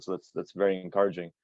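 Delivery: ordinary talk, nothing marked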